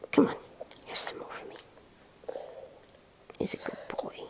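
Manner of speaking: soft coaxing whisper. Real, not acted. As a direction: murmur praise gently.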